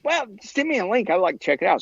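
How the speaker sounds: high, cheerful greeting in a lightly put-on voice; imitating the pond owner. Pond owner greeting Shannon, lightly mimicked high and cheerful. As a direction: mimic a friendly guy picking up the phone. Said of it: "It's like".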